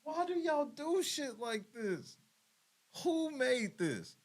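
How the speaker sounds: laughing disbelief. Real, not acted.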